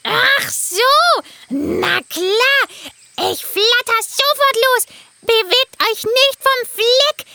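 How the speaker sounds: high eager bird voice, slowing at the end; a helpful parrot ready to fly. Acted. Parrot character voice.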